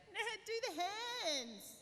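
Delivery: high, loud, drawn-out call; mock heckling demand. Relatives demanding the impersonation, high drawn-out shout. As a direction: whoop it out like an eager crowd. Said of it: "people would ask me to do the impersonation".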